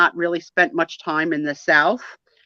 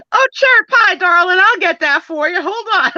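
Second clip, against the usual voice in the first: Southern accent impression; 11 semitones higher, 6 dB louder than usual. Host imitates Steve's Southern accent, hammed up and high. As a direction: ham up a sweet Southern charmer offering help. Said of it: "really Southern, like, crazy expressions".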